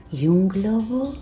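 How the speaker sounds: slow, coaxing creepy clown; giggly sinister sing-song. Acted. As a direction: dangle the offer sweetly. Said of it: "Soy Pennywise, el payaso".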